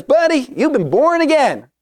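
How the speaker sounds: hearty retold Texan buddy, pitched well up; big Texan. Big Texan friend to a new believer after slapping his back, high-pitched big Texan. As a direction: play the big Texan pal, high and emphatic. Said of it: "you know, big Texan".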